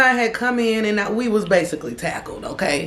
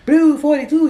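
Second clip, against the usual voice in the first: higher, louder play call; mock quarterback call; 8 semitones higher, 5 dB louder than usual. Teller joking they are a football team at the line, mock play call.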